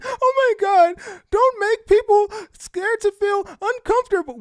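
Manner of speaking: high, loud whiny mock of her crying voice; mocking fake crying. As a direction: parody her tearful speech in a shrill squeal. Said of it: "up here fake crying".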